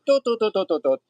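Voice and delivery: high sing-song doots tumbling down; playful doot-doot noises. Retold or imitated. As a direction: toot out a carefree little walking noise, falling in pitch. Imitates own carefree walking out.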